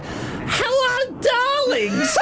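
very high, laughing camp voice; hammed-up high-pitched impression; Alan Whicker. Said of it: "We'll have that".

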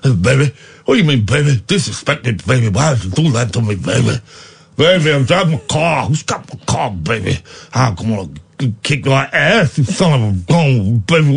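low, gruff mock Vin Diesel drawling baby; lightly growled tough-guy lines ending in baby; low mock action hero voice; Vin Diesel impression. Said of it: "You know the guy I'm doing?".

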